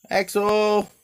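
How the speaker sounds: loud high call across the yard; calling the dog. Real, not acted. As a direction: shout the dog's name from the porch.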